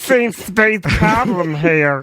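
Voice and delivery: slow put-on official voice; hammed-up manager character; mock manager. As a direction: play the arriving manager, drawn out. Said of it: "The manager comes back".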